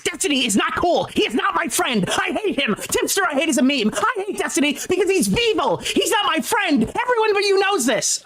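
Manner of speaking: sarcastic high-pitched parody of an angry rant; mocking shrill rant. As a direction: ham it up like a squealing ranting kid. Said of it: "it is".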